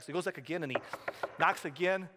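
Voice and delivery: ordinary delivery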